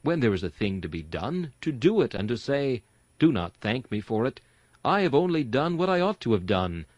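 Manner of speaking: usual speaking voice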